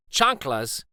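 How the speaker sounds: plain reading voice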